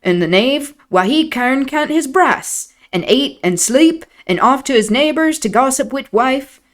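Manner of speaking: put-on broad old Yorkshire servant voice; Yorkshire dialect. Nellie voicing old Joseph in thick dialect. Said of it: "Nellie doing an impression of Joseph".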